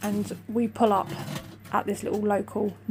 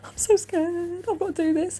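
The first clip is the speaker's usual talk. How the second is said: imitating her own crying fear; tearful; 8 semitones higher than usual. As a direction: whimper it like you're close to tears. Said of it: "crying with tears".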